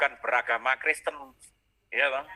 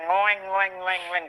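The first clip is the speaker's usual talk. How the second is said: mock guitar noise; 4 semitones higher, 3 dB louder, slower than usual. Imitating loud guitar at church.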